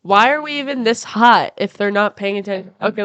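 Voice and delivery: usual speaking voice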